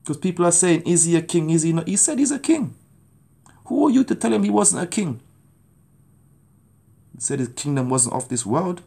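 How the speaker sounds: ordinary talk, nothing marked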